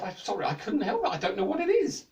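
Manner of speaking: high, giggly sorry; the jester laughing as he apologises; laughing apology. A narrator's reading.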